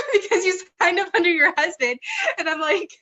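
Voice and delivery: laughing. Real, not acted. Laughing at husband signup story.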